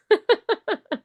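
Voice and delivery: very high, loud laugh; high laughter. Real, not acted. After the closet story to the host, high laugh. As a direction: laugh it off big.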